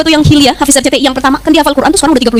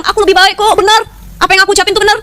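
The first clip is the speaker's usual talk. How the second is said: higher-pitched mock insistence; stubborn self-justification; 7 semitones higher than usual. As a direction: insist you are right, lightly hammed-up as Iblis. Acting out Iblis insisting he is right. (ngotot merasa benar, nada agak tinggi)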